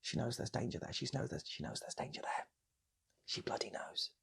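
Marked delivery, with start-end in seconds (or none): none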